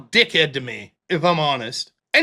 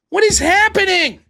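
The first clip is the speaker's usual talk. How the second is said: exasperated, high and loud; 10 semitones higher, 5 dB louder than usual. Real, not acted.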